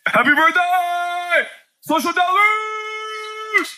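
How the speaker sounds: hammed-up cheerful churchgoer; gushing church greeting. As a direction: stretch it out with overdone cheer. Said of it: "like Tigger".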